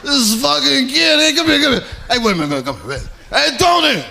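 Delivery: high, excited mobster calling his pals over; amused mobster. Retold or imitated. Mobster calls Tony over to see the kid, amused holler. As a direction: call out in mock-mobster delight at the kid. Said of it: "he started laughing".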